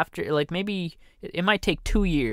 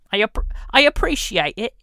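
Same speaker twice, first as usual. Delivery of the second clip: higher, louder mock British accent on "I appreciate it"; 9 semitones higher, 7 dB louder than usual. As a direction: ham up a British accent, pitched well up. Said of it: "make a lot of fun about the British and the accents".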